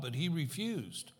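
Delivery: ordinary talk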